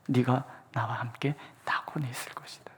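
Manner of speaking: reverent whisper. Retold or imitated. Quoting Jesus reverently.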